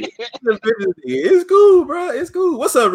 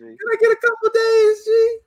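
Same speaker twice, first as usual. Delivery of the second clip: high, hammed-up whine for more days; mock whiny plea; 14 semitones higher than usual. Host begs co-host for more days before picking, mock whine. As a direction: beg like a kid, half joking.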